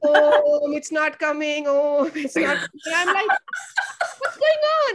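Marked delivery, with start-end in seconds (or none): 0.0-2.8 imitating kids chanting Om on the toilet, laughing; a loud laughing Om, then a complaining whine; kids straining. Teacher imitating her students chanting Om on the toilet. 4.2-4.9 much higher, laughing shocked question. Retold or imitated. Teacher retelling her shocked reaction to the kids, much higher.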